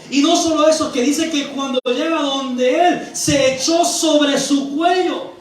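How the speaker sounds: loud, slow, stretched-out proclamation; impassioned preaching. Real, not acted. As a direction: build to a forceful sermon climax. Preaches at full force. (predicación a toda fuerza, alargando las palabras)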